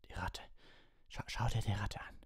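halting, whispery croak; breath-heavy, urgent rasp. A narrator's reading. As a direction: plead hoarsely, stumbling on the words. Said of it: "krächzte er".

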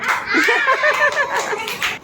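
high giggling laugh; amused giggle; laughter. Real, not acted. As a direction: laugh at the one who's out. Laughter after someone is out.

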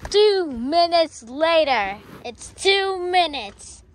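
slow, stretched time card; drawn-out time-skip announcement. Pretend. Announcing the time skip to viewers, slow and stretched. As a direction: draw each word out like a caption.

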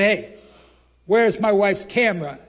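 retold shout. Husband confronting the bag lady, loud angry demand. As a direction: call out after her, accusing.

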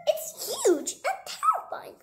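high-pitched squirrel wail; frightened cry. Acted. Squirrel told to keep describing, frightened cry. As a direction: cry it out high in hammed-up terror. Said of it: "cried the squirrel".

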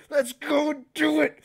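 high, mock-heroic rallying call; imitating brave friends. Friends rallying for their vaccines, hammed-up bravado. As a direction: pump up the group, hammed-up. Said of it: "Me and my friends were just like".

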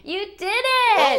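loud, high congratulation; big high-pitched praise; excited cheer. Pretend. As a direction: cheer the kids on loudly. Cheers children after the song.

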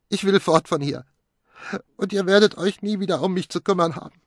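high, loud voice on the edge of tears; hurt and near tears, pushing them away; tearful outburst. Acted. Pilar telling the family they spoiled everything, high and tearful. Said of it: "Tränen erstickten ihre Stimme".

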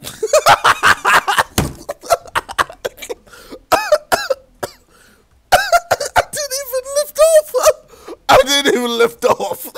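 high, squealing words through laughter; helpless laughing. Real, not acted. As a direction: barely get it out for laughing. Laughs hard at instant failure.

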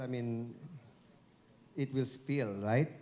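ordinary delivery

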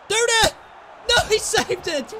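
sudden high yell of his name; real excitement; excited shout. Real, not acted. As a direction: shout it as the shot comes in. Excited chance at goal.

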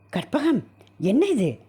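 a high, startled cry of her name; as if the paper just knocked the breath out of him; stunned. Acted. Husband sees the wedding news, startled cry. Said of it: "திகைத்துப் போய் கேட்டான்".

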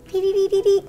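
high repeated "dee" blips; little game noises. Pretend. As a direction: imitate a cute video-game sound effect. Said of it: "I make little noises just like the game".

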